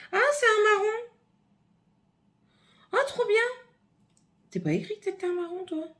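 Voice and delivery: high, excited squeal of surprise; thrilled to discover the shade; delighted surprise. Real, not acted. Surprised by unexpected brown shade. (surprise ravie, voix très aiguë)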